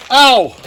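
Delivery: sharp cry of pain; pained yelp. Real, not acted. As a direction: yelp as the finger is cut. Cut finger on metal.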